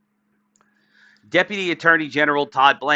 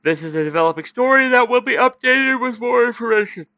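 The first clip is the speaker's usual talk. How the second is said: talking through a yawn; 10 semitones higher than usual. Real, not acted. Streamer finishing the article to viewers, mid-yawn. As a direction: pitch pushed high by a yawn mid-sentence. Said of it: "apologize again for fucking yawning".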